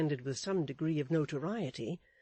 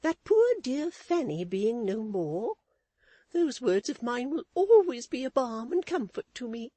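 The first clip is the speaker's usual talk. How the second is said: much higher, prim lady's voice; Missus Chick's voice; 9 semitones higher, 8 dB louder than usual. A narrator's reading. Wife lecturing her husband, in a high prim voice. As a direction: preach a lesson, high and proper.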